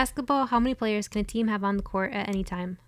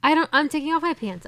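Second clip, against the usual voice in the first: higher and louder, exasperated outburst; loud exasperated exclaim; 6 semitones higher, 6 dB louder than usual. Real, not acted. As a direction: blurt it out in frustration. Loses the question, exclaims.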